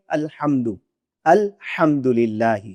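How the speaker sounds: الحمدللہ slowly with a soft ح from mid-throat; correct soft ha. Shown as a demonstration. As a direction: recite الحمد with the proper, soft ح. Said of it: "جب ہم ہا کو ٹھیک پڑھیں گے".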